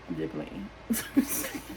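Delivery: low, slow, laughing through the words. Real, not acted. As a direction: chuckle it out as you take back the joke. Laughing at own joke.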